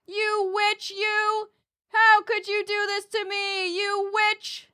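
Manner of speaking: high, loud outraged yell; crying with rage. Real, not acted. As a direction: yell the curse in despair. Cursing the game character.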